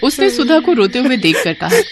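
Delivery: usual speaking voice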